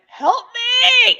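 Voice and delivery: re-enacted desperate high scream; screamed plea for help. Victim screams for rescue while being choked, screaming. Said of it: "I was screaming, "Help me".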